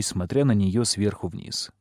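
usual speaking voice

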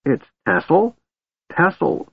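put-on high, halting, amazed old voice; old mage stammering. A narrator's reading. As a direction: stumble over the name in shock. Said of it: "he stammered".

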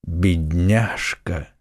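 low, very slow pitying murmur; hushed, husky and grief-struck; hoarse whisper. A narrator's reading. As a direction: whisper it hoarsely, drawing out the word. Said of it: "хриплым голосом шептал Атос".

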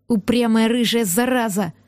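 ordinary delivery, nothing marked

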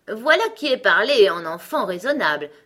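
loud, self-satisfied approval. A narrator's reading. Gros Coco praises Alice's question, satisfied. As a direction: approve with smug emphasis. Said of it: "d'un air très satisfait".

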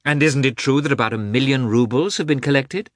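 high-pitched, eager society lady; eager princess. Acted. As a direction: voice a princess keen to be proved right. Voices the princess in dialogue.

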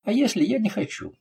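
high-pitched defiant grumble; grumbling. A narrator's reading. Thug balking at the captain's order, grumbling. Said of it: "проворчал Беккао".

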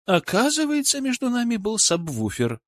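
high-voiced reproach. A narrator's reading. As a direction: pitch it way up, reproaching a boyfriend. Gulia's mock-hurt realisation about boyfriend.

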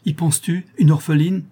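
high, pleading exclamation; shocked outcry. A narrator's reading. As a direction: appeal to reason in an appalled high voice. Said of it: "s'écria Isabelle".

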